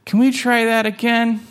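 high, hopeful little kitten; eager kitten voice. Acted.

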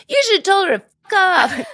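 high, fast mock-indignant yelp; indignant outburst. Teenage cousin outraged on the kid's behalf, mock-indignant outburst.

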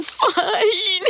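laugh-cry. Real, not acted. Laughing as she starts crying.

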